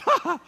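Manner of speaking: high, loud ha-ha; imitated friend's jeering laugh; mocking laugh. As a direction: laugh at him in a high pitch. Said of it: "He just laughed at me".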